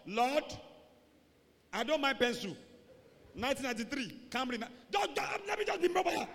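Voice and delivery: high, bargaining prayer, hammed up; mock desperate pleading. Preacher mimicking a believer lowering his car request, pleading frantically.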